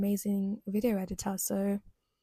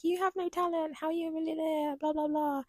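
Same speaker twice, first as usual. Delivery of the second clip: much higher, snide mocking voice; hammed-up nasty commenter; mock online hater; 6 semitones higher than usual. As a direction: sneer like a pile-on troll. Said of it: "dogpiling on them and being like".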